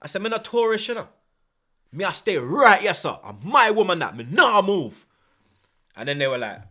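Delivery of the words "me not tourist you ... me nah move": higher, louder Jamaican patois; Jamaican accent. Retold or imitated. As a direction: stand your ground in a Jamaican accent. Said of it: "And put on the accent".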